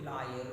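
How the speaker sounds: low, level LAWYER, no punched syllable; Telugu pronunciation. Shown as a demonstration. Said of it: "In Telugu".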